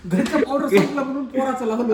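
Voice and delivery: laughing. Real, not acted. Joking about using the child's powder.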